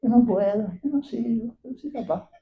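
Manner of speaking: small, soft self-doubting mumble; mock timid excuse. Mimics believers who excuse themselves.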